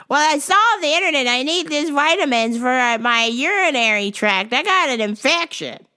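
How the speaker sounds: put-on high, loud fussy old lady; high customer voice. Old customer complaining to the clerk, high fussy whine. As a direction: whine about the vitamins.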